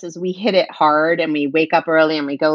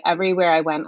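ordinary talk

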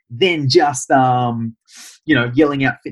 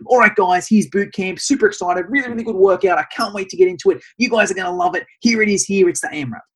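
higher, pumped-up trainer voice; super excited; 6 semitones higher than usual. Shown as a demonstration. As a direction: sell the workout with big energy. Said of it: "see the difference in my voice there".

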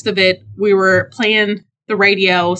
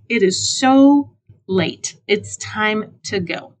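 slow, drawn-out complaint; emphatic exasperation; 2 semitones higher, slower than usual. Retold or imitated. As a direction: stretch each word to stress the point. Retold weary decision to head home.

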